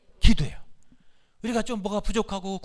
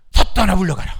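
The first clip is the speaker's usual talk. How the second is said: loud, forceful shout; commanding rebuke; 11 dB louder than usual. Shown as a demonstration. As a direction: shout down the devil. Preacher models rebuke for congregation. (사탄을 쫓는 힘찬 외침)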